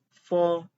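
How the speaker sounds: "fo" said clearly for repeating; model syllable. Shown as a demonstration. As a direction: pronounce "fo" for students to copy. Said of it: "F pronounced as fo".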